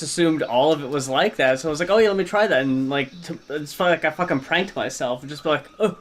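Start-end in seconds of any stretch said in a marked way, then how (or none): none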